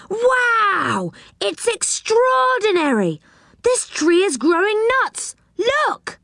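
high-pitched cry of amazement; excited shout. A narrator's reading. Rick spots nuts on the tree, excited high shout. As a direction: call out a thrilling discovery in a high voice. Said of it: "Rick gave a shout".

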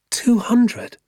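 high, loud disbelieving question; boy can't believe the number; astonished. Acted. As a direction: gasp out the number in shock. Boy astonished at father's plan.

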